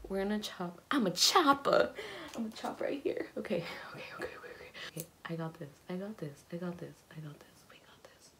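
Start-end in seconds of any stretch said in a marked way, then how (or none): 0.9-1.9 high, loud bravado; excited. Real, not acted. Bold declaration before cutting. 2.4-8.3 fast nervous whisper, fading out. Real, not acted. Psyching up before cutting.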